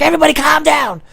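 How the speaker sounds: very high, loud mock shriek; frantic girlfriend. Girlfriend shouting over a fight, imitated high shriek. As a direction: yell for order as the girlfriend. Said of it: "his girlfriend ran out".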